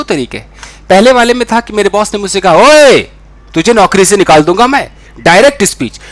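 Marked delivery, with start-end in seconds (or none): 2.5-4.9 loud threatening boss yelling at his employee; angry boss. Retold or imitated. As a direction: bark it like a furious boss. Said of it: "वो acting कर रहा था".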